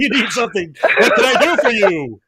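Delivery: high and loud, playfully overeager; host mimicking the guest's eager reply, loud; loud eager echo. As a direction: ham up the eagerness. Listener echoes eager tone.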